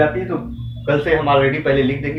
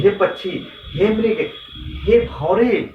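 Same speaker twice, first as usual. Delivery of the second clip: raised, appealing calls, lightly acted; Ram calling out; 6 semitones higher, slower than usual. Ram asking birds and deer in the forest, lightly acted calling. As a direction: call out to each creature in turn, higher. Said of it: "जाते हैं पक्षियों से पूछते हैं".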